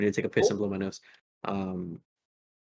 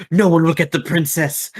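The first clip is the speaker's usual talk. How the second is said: defiant guard; 7 semitones higher, 7 dB louder than usual. Acted. Thrall guards the door.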